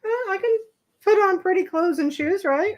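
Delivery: high, prim lady voice; mock ladylike. Talks about dressing up, in a high mock lady voice. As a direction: play at being dainty. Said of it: "I can be a lady".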